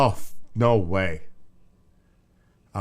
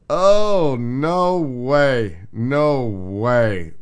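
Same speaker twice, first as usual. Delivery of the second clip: loud disbelief; 2 semitones higher, 8 dB louder, much slower than usual. Real, not acted. Reacting loudly to spider video.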